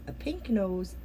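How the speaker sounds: ordinary delivery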